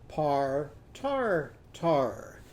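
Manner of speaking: "Par, tar, tar" as long separate syllables; "ar" in every syllable. Shown as a demonstration. Teacher puts Arnie's sound in potato, "Par, tar, tar" separated. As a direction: stretch each "ar" in "Par, tar, tar", pausing between. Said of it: "use his sound three times".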